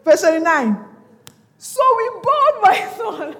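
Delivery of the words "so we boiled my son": high, loud, slow, breaking into laughter; laughing while reading. Real, not acted. Preacher reads grim verse to church, laughing incredulously. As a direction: read it out, cracking up.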